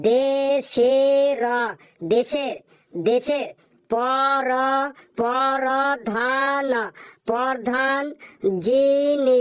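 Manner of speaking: slow, stumbling syllable by syllable reading; halting reading. Acted. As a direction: spell it out haltingly like a struggling pupil. Halting child reading aloud. (থেমে থেমে হোঁচট খেয়ে পড়া)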